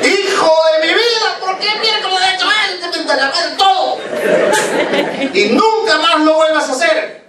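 very high-pitched, frantic scolding; screaming mother. Acted. Mother to the son who ran across the street, screaming scold. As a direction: shriek it like an enraged mum, pitch way up. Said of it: "Gritó".

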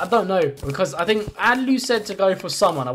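ordinary talk

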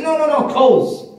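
high-pitched, emphatic objection; insistent refusal. Acted. Acted refusal of a shop suggestion.

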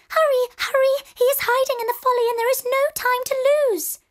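very high, fast bird voices; the birds hurry Belle, very high and fast; urgent twittering birds. A narrator's reading. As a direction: chirp it out in a rush. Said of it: "The birds tweeted".

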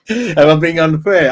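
giggling as he asks, teasing; laughing. Real, not acted. Streamer to chat after a joke, laughing through the words.

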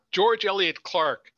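plain reading voice

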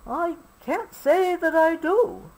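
high, distant character voice; lightly voiced bird character; ostrich voice. A narrator's reading.

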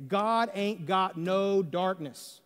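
slow, deliberate "God ain't got no darkness"; emphatic, slow. Shown as a demonstration. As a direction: lay each word down slowly. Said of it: "It's emphatic when you say it that way".

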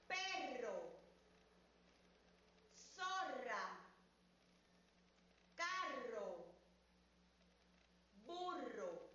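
each word "Perro", "zorra", "carro", "burro" said slowly with strong rr and pauses; careful model. Shown as a demonstration. Teacher models the four rr words for review. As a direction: give each word its own slow trill. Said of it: "Observa bien".

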